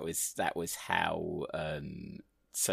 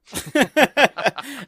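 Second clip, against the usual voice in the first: delighted laugh; 14 dB louder than usual. Real, not acted. Delighted at the story's punchline.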